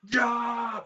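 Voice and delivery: high, loud yell of joy; mosh pit yell. Retold or imitated. Former enemy grabs him in the pit, yelling. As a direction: whoop it while grabbing your mosher buddy.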